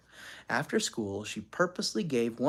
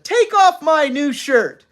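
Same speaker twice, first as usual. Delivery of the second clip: loud, high, slow demand; angry order; 11 semitones higher, 9 dB louder, slower than usual. A narrator's reading. Father wanting his shirt back, ordering angrily. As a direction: demand it at full voice.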